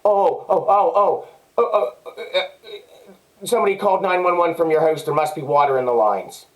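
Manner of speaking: a lightly voiced stammering cop caught out, then rushing the excuse; flustered officer. Officer caught sneaking at night stammers an excuse to the neighbor, flustered and hurried. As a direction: stumble through the excuse like a caught cop, fast. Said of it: "Was like".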